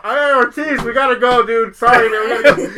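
loud call through a covered mouth; calling from far off. Retold or imitated. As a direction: shout as if from across the room. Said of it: "I cover my mouth so it looks like I'm far".

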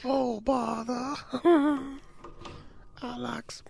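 high, slow cartoon bear impression; Winnie the Pooh. Doing a cartoon bear for friends, high slow voice. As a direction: draw it out like an old man. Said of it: "That's Winnie the Pooh".

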